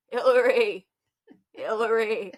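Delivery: a hint of an old man's Maine accent, calling her name; Maine accent grandpa. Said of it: "little old Maine accent go".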